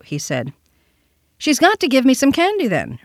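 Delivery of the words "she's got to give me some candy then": put-on high-pitched insistent little boy; young boy. A narrator's reading. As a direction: demand it in a raised child's voice. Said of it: "rejoined his young interlocutor".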